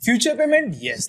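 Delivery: ordinary talk, nothing marked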